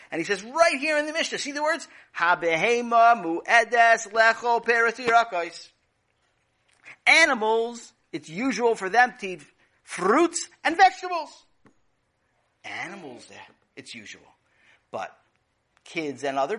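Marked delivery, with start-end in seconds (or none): 0.4-11.5 high, loud proud recital; a kid showing off what he learned; high excited reading aloud; eager child reading. Acted. Child proudly reads the Mishnah.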